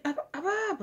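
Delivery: laughing up up. Retold or imitated. Kid retelling a cartoon chase, high giggly cries. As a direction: squeal it with a laugh. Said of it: "and then it's like".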